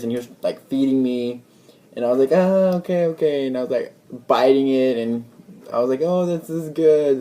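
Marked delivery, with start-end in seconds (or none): 2.3-3.5 high-pitched awkward agreement; reluctant going-along. Retold or imitated. Man being fed by his pushy date, reluctantly agreeing. 6.0-7.2 high, drawn-out approval; retold polite enthusiasm, slow and pitched up; polite put-on praise. Man fed sushi by his date, politely praising it.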